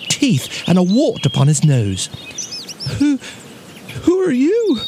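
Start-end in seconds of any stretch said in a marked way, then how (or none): 3.0-4.8 high, timid bear voice; small, high-pitched nervous question; frightened little bear. A narrator's reading. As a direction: ask as a scared little bear. Bear meets a fierce giant.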